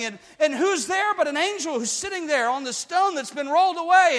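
high, driving preacher's voice; full-force preaching. Acted. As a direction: push the story forward with fervor.

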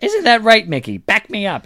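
high, insistent hag; Mad Maggie. Acted. Hag merchant prodding her demon to agree, high insistent voice. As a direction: demand backup in a high crone voice. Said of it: "Mad Maggie has the finest prices".

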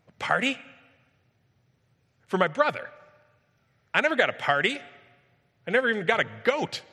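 high, loud, incredulous protest; the older son fuming at the injustice; indignant outburst. Acted. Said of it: "And it burns him up".

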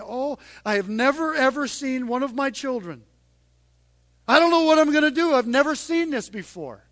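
hammed-up flustered voice; panicked God. Preacher mocking a God shaken by sin, flustered.

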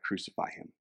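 a retold shout, whispered instead; whispered crowd yell. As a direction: hush the mob's cry to a whisper.

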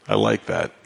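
ordinary talk, nothing marked

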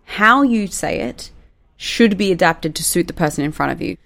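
ordinary talk, nothing marked

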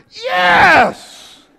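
high, explosive cheer; triumphant shout. Acted. Preacher as revived Lazarus, shouting in triumph. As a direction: burst out in victory. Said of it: "I think he went".

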